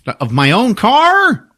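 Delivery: loud, high, drawn-out whine of disbelief; squawked indignant question; acted incredulous protest. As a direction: protest in mock outrage. Lackey protests being ordered out.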